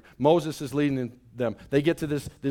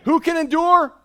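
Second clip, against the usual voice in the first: loud, higher call to the room; preacher's call; 11 semitones higher, 7 dB louder than usual. Real, not acted. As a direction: call out for an answer. Call and response with congregation.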